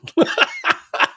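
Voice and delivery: high burst of real laughter; laughing. Real, not acted. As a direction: crack up helplessly. Cracks up at the accent.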